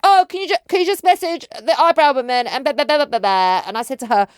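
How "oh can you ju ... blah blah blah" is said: put-on high, loud whiny teen ask; imitating teen daughter. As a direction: put on a pleading teenage voice. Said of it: "My eldest daughter came in".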